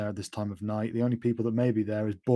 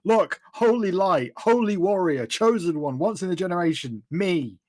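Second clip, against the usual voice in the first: higher, emphatic list of titles; exasperated boasting; 5 semitones higher than usual. Acted. Chosen one shows holy light to Felix, exasperated boasting. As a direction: reel off your titles, insistent. Showing off her credentials to a doubter.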